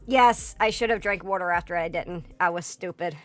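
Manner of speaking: ordinary talk, nothing marked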